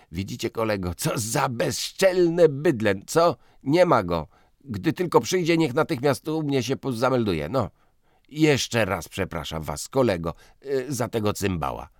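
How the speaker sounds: loud, high, heated rant; blustering editor. Acted. Piotrowicz fumes about the cheat to his guest, loud and heated. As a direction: grumble loudly about the scoundrel.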